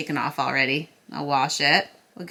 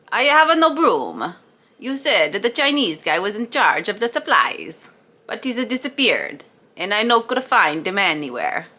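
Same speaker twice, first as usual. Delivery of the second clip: put-on Italian accent. Italian worker in ethnic joke.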